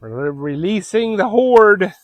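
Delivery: mock epic announcement. Announcing animals let out.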